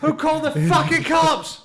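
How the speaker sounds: furious rioter bellow; angry high shout. Acted. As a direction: yell it way up high. Rioter yelling at cops.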